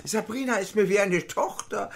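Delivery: mocking a nagging wife; hint of a scolding woman; shrill nagging. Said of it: "pflegte Bernadette zu keifen".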